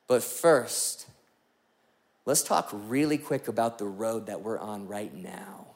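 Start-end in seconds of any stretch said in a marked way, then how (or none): 2.3-5.7 a quick hushed whisper aside. Real, not acted. Pastor whispering an aside to the congregation before the ad. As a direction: lean in and whisper it to the crowd.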